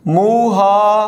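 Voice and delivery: محافظ drawn out slowly and clearly; teacher modelling محافظ slowly; slow correct pronunciation. Shown as a demonstration. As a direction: stretch محافظ so students hear each sound. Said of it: "یہ لفظ ہے محافظ".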